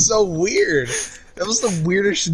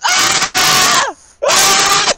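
frustrated yell; 5 dB louder than usual. Real, not acted.

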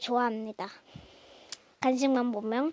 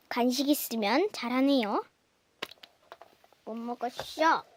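ordinary delivery

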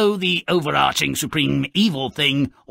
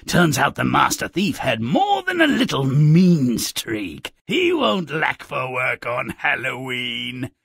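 ordinary delivery

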